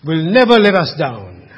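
normal talking voice

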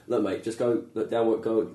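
a bad Australian accent, only lightly put on; a rough Aussie bloke telling you to get to work, lightly done. Interviewer mocks school career advice in a weak Australian accent. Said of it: "it's a terribly Australian accent".

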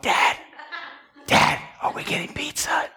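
imitating a child's insistent whisper; whisper in the ear. Son pestering his napping dad for pizza, whispered. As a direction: whisper it right into a sleeper's ear. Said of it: "In my ear".

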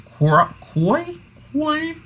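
testing out how to say "Croix"; unsure pronunciation. Real, not acted. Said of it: "y'all know how I am".

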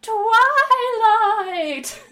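long, high, loud call of Twilight; dramatic drawn-out summons. Acted. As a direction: stretch the name out in a theatrical holler. Second pony calls out grandly.

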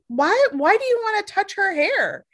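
high, disbelieving why; incredulous retort. Retold or imitated. To strangers reaching for her hair, a high incredulous retort. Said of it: "I'm like".

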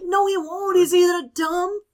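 high-pitched exasperated comeback. Retold or imitated. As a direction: argue back in worked-up disbelief. Her exasperated comeback to dad, retold.

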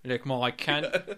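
put-on slow, drawn-out Australian woman's accent. Co-host hamming it up for laughs, Australian accent. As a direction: stretch it out in broad Australian. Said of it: "She's Australian".

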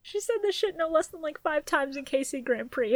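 usual speaking voice

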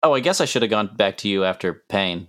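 usual speaking voice

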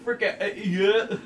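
mock angry yell. Imitating his friend yelling at him over the phone, loud mock yell. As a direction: bark it back as if the caller is an idiot. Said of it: "Yeah, you were like".

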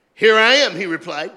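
loud, high preacher's reading; whooping sermon reading; full-force preaching. Acted.

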